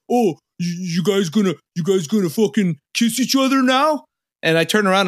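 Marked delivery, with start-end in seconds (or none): 0.1-4.0 high, taunting jeer; hammed-up jeering bro; mocking drivers. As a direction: sneer it like a jock in a car. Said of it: "they're like".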